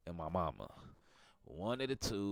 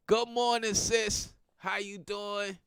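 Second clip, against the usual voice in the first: loud greeting; 10 semitones higher, 8 dB louder, slower than usual. Real, not acted. Greeting a viewer loudly.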